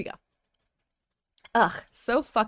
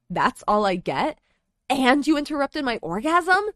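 angry; 6 semitones higher, 8 dB louder than usual. Acted. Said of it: "Lumine was angry".

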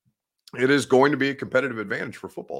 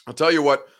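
usual speaking voice

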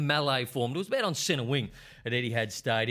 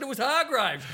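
much higher, louder mock protest; retold protest; 12 semitones higher, 3 dB louder than usual. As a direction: plead that it wasn't you. Retold protest during brawl.

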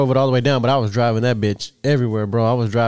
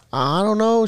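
high, meek daughter voice; mimicking daughter; 9 semitones higher, slower than usual. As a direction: squeak it like a flustered teen.

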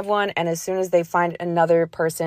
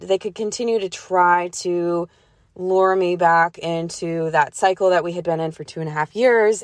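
usual speaking voice

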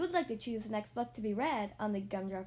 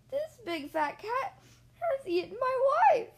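much higher, louder, wailing bird voice; crying; 13 semitones higher, 8 dB louder than usual. Acted. Mister Bird thinks the cat ate his wife, high wailing cry. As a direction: wail in despair. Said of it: "he cried".

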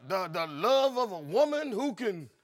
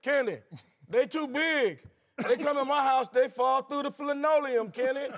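higher-pitched mock complaint; put-on higher voice, pleading for agreement; mocking Charles Barkley; 4 semitones higher than usual. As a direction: imitate a man griping to his buddy. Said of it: "always talking about".

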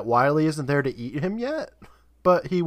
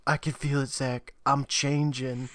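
ominous hush; 3 semitones lower, 6 dB quieter than usual. Retold or imitated. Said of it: "And he's like".

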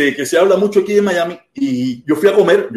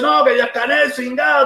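imitated worked-up heckler, high-pitched; mock a man getting on his high horse; raised-pitch mock indignation; agitated attitude; 7 semitones higher, 3 dB quieter than usual. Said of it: "ahí empieza ahí con su actitud".

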